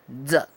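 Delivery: "Duck" with the D set further back; D sound demo. Shown as a demonstration. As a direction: model the opening D of "Duck". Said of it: "de acá atrás".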